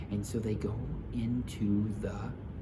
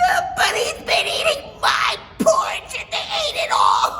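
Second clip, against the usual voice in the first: very high, loud crying cub; wailing Baby Bear; 10 dB louder than usual. Acted. Baby Bear crying over his empty bowl. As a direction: wail it through tears. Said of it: "He's crying".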